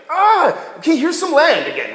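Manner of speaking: high, loud resigned sigh of a king; exasperated king. Retold or imitated. King giving Abram land after the deceit, high and exasperated. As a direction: give up and hand over the land. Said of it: "And he's like".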